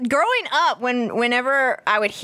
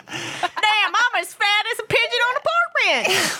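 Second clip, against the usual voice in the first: put-on Southern accent; 10 semitones higher than usual. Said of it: "you can't say it with that accent".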